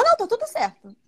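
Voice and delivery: high, insisting denial; imitating the bride insisting. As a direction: pitch up the bride's stubborn "não". Noiva repete a negação. (imitando a noiva insistindo, voz aguda)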